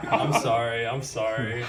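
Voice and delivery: ordinary talk, nothing marked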